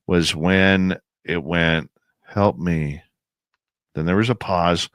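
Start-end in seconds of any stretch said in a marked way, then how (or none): 2.2-3.0 flat, low call for help with no feeling; eerie voice that isn't human, lightly voiced; monotone woman's voice. Mimicking the voice in the woods, a hint of flat monotone. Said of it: "very monotone".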